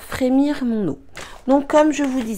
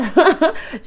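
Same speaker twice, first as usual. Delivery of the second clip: rire bref et aigu, un peu gêné; petit rire gêné; 7 semitones higher, 6 dB louder than usual. Real, not acted. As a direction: rit de sa tenue en pyjama. Rit d'être en pyjama. (rire amusé en avouant être en pyjama)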